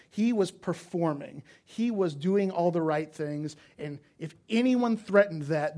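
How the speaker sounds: rising sermon intensity; emphatic preaching. Acted. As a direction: drive the point home slowly from the pulpit.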